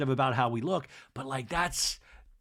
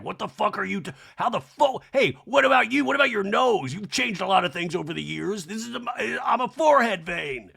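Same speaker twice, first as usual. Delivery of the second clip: indignant, raised mock retort; Randy; 6 semitones higher, 4 dB louder than usual. Forehead vein answering back in the showdown, mock outrage. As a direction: fire back in hammed-up outrage.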